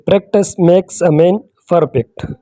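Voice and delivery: very slow, word by word; quoting the proverb. Retold or imitated. Speaker quoting an English proverb to learners, slowly. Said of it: "انگریزی میں بھی لوگ کہتے ہیں".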